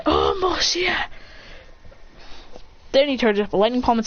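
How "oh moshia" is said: high, drawn-out mock shout; acted cry. Boy answering an overheard insult, high drawn-out cry. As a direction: drag the words out high.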